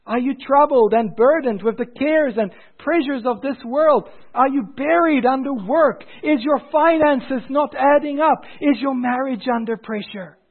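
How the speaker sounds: high, loud, ringing preacher's call; fervent sermon delivery, pitched high; preaching at full force. Acted. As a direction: preach it out to the whole church. Preacher addressing congregation.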